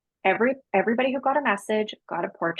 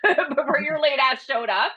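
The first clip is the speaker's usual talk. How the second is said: laughing tease; 8 semitones higher, 3 dB louder than usual. Retold or imitated. Laughing while scolding late friend.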